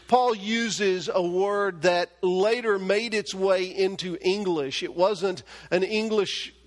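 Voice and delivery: ordinary talk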